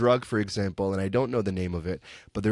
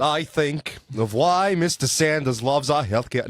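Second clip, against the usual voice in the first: put-on Bernie accent; 5 semitones higher, 4 dB louder than usual. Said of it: "do the entire answer in his accent".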